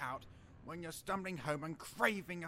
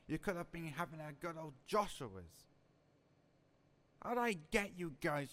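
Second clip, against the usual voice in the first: ordinary delivery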